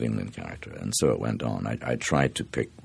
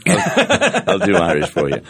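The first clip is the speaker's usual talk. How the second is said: laughing through the words, much higher and louder; 8 dB louder than usual. Real, not acted. Joking to interviewer about paid accents, laughing. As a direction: crack up while offering the accent.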